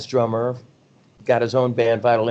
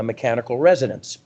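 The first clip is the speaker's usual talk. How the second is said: ordinary talk, nothing marked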